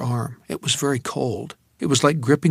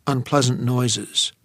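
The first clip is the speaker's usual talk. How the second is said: usual speaking voice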